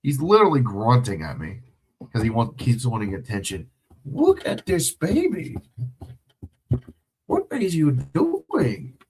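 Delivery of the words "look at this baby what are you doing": high, slow cooing pet voice. Real, not acted. Streamer fussing over his dog in slow high baby talk. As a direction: drag it out sweetly for the dog. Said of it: "my voice changes".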